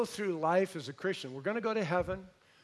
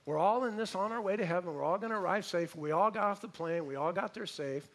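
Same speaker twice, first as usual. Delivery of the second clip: ordinary talk, nothing marked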